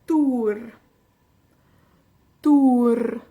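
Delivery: dŵr said with a strong rolled r, loud. Shown as a demonstration. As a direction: roll the R hard in dŵr. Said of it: "Con R".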